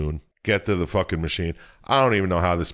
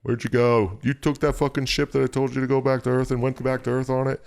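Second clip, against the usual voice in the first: higher, exasperated mock hero voice, only lightly put on; lightly voiced Isaac; 7 semitones higher than usual. Host voicing Isaac scolding Ellie, lightly exasperated. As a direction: complain like an annoyed rescuer.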